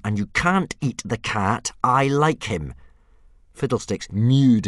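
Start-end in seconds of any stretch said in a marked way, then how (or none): 0.0-2.7 tense, raised-voice insistence; losing calm. A narrator's reading. Hiccup forbids eating the cat, losing his calm. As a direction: insist as patience slips away. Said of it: "feeling all his calmness deserting him".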